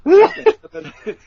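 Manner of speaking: loud high laugh; burst of laughter. Real, not acted. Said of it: "eating the line through my teeth".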